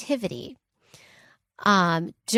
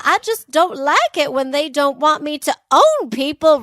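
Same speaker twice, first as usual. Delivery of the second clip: put-on Southern belle accent; 6 semitones higher, 6 dB louder than usual. Podcaster mocks a wealthy Southern lady, in a high Southern belle accent. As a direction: ham up a prissy Southern belle. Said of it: "She had a very thick Southern accent".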